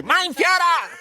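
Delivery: put-on high, slow, barked German accent; mock German accent. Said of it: "that was a good German accent this time".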